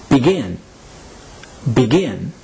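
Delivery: begin, with a strong falling "gin"; stress on last syllable. Shown as a demonstration. Teacher models begin for learners, stressing the last syllable. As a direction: hit the second syllable of begin. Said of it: "is pronounced".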